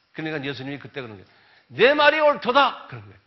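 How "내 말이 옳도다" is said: loud, much higher, slow; solemn declaration. Retold or imitated. Preacher voicing Jesus answering Pilate, loud and solemn. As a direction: proclaim it slowly and loudly.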